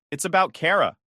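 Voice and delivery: usual speaking voice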